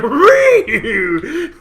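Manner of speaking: high, loud, laughing squeal; laughing dog squeal. Retold or imitated. Imitating the dog playing, laughing.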